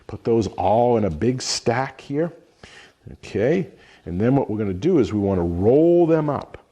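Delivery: ordinary talk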